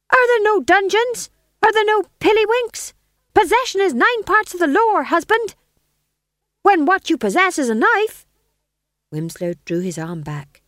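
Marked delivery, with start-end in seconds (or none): 0.1-8.2 high, loud taunting wife; scheming wife voice. Acted. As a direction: egg him on in a shrill stage voice. Gumridge as Lady Macbeth.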